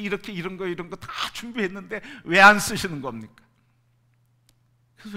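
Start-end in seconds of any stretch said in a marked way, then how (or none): 0.0-3.4 rising, loud frustrated plea; frustrated prayer. Retold or imitated. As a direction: press God with a bitter question. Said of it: "기도할 때 그랬어요".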